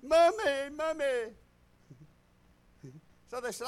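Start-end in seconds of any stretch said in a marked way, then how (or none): 0.0-1.3 high mock wail for mommy; hammed-up toddler bawling, pitched high; imitating screaming child. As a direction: cry out like a hurt little kid in the nursery. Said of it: "And he started screaming".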